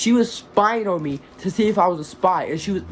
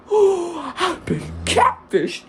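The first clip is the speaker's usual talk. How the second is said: mock cry; 11 semitones higher, much slower than usual. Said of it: "I was like".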